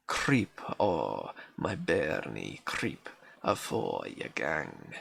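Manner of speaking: reading a Scots lullaby poem in a broad Scots accent. Shown as a demonstration. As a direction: roll the Scots words gently, like a granny's old song. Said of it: "forces you to read in an accent".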